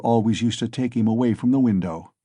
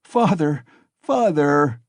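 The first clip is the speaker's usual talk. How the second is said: loud, higher pleading cries; a child crying out; 4 semitones higher, 3 dB louder, slower than usual. Acted. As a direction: call to his father in distress. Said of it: "he cried".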